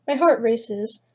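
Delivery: usual speaking voice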